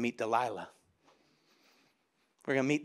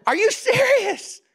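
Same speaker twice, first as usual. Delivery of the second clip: high-pitched, loud, drawn-out disbelief; incredulous outburst; 5 dB louder, slower than usual. Real, not acted. Preacher reacting to Delilah's complaint, loudly incredulous.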